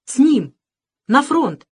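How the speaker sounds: high loud eager cry; eager shout. A narrator's reading. Boy decides to go to war, excited shout. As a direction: fire up with excitement.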